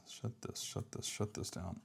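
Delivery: breathy half-whisper while closing things; whispered to himself. Real, not acted. Coder closing windows whispers to himself. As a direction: whisper it under your breath as you work.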